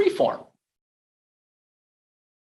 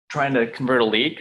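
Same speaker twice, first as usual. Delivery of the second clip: usual speaking voice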